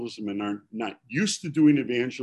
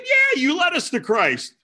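caller's voice; 4 dB louder than usual. Retold or imitated. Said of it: "He said".

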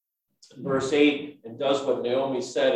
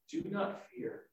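whispered reassurance; 12 dB quieter than usual. Acted. Boaz calming Ruth in the night, whispered. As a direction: whisper it high and tender.

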